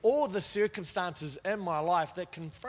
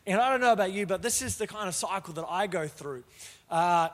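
ordinary talk

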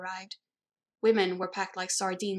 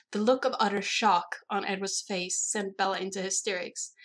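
ordinary delivery, nothing marked

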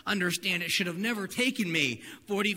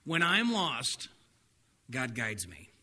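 solemn big idea; 6 semitones lower, slower than usual. Shown as a demonstration. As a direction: land the main point gently. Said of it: "the big idea for today".

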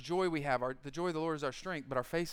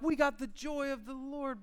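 mock boasting; 6 semitones higher than usual. Mocking church boasting.

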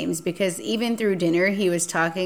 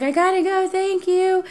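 much higher hurried goodbye; running to her car, high and hurried; hurried escape; 12 semitones higher than usual. Retold or imitated. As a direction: blurt it while running away. Running off from date.

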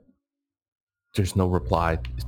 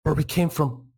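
high, strained and distressed; distressed Bambi; 8 semitones higher than usual. Acted. GM voicing Bambi's desperate message through the stone, in a high strained voice. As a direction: gasp it out as if in danger. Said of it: "She says".

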